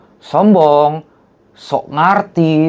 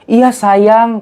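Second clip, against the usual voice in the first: sweet reply; 8 semitones higher, 4 dB louder than usual. Retold or imitated. Jupe answering fan sweetly.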